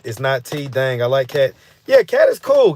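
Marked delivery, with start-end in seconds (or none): 1.9-2.7 sudden high-pitched yes, Cat is cool; high excited agreement. Real, not acted. Streamer reacting to chat, excited high agreement. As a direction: brighten way up on agreeing.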